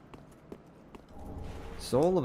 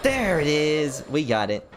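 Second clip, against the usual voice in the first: excited; 6 semitones higher, 5 dB louder than usual. Real, not acted. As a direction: shout it out, relieved and thrilled. Finds the item after searching.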